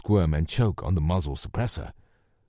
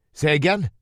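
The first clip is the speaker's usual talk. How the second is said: louder, higher shout over noise; yelled; 9 semitones higher, 6 dB louder than usual. A narrator's reading. Lou yelling to Rosie over the rotor roar. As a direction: yell over a roaring helicopter. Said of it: "Lou yelled over the roar".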